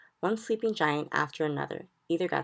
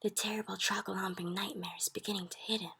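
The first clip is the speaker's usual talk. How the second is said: soft, breath-only running commentary; hushed giant's whisper; 3 semitones higher, 7 dB quieter than usual. A narrator's reading. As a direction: whisper it secretly while watching. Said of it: "the B F G whispered".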